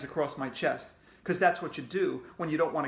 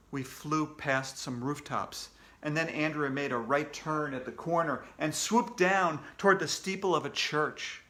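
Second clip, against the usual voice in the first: ordinary delivery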